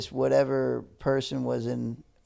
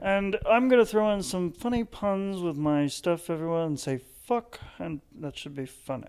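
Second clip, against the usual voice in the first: British announcer; 3 semitones higher than usual. Retold or imitated. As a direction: draw it out in a bad British accent, fading at the end. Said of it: "this is my British accent".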